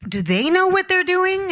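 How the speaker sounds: can't believe they'd do this; incredulous. Retold or imitated. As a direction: climb high in amused disbelief. Voices own disbelief at studio.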